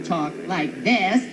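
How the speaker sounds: slow, drawn-out camp demo. Shown as a demonstration. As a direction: stretch it in a put-on effeminate lilt.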